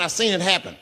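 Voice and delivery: ordinary delivery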